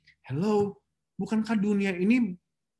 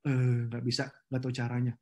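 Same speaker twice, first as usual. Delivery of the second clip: lower, limp and unwilling; mock helpless refusal; 7 semitones lower, 4 dB quieter than usual. Preacher mimics a lazy employee refusing the boss. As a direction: shrug it off like a useless worker.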